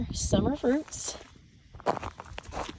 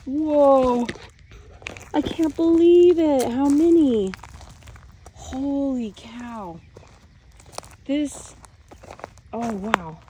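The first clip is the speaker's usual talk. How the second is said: amazed; 5 semitones higher, 6 dB louder, slower than usual. Real, not acted. Amazed at the seedling count.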